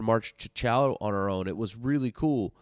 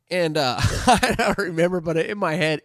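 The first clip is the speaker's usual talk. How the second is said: ordinary talk, nothing marked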